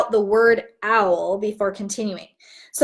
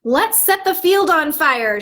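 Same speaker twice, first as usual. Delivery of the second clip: a crow's excited shout, pitched up; 6 semitones higher, 4 dB louder than usual. Acted. A crow shouts its idea to the flock, loud and excited. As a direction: call it out as the bold crow. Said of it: "shouted a crow".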